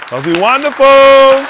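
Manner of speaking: slow, high and loud; drawn-out praise. Real, not acted. Calling out praise for Mister Hu, slow and loud. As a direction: stretch it out like a cheer.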